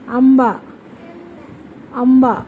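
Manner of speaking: आंबा said firmly as one separate word; word modelling. Shown as a demonstration. Mother teaching her child to say आंबा, modelling it. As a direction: say आंबा distinctly for a child to repeat. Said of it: "म्हण आंबा".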